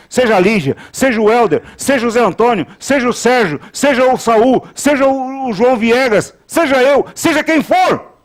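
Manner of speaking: rising pulpit crescendo; impassioned preaching. Real, not acted. Preacher builds through list of names.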